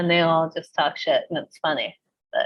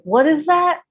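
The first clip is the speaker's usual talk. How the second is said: imitated bafflement; 9 semitones higher than usual. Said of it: "he's like".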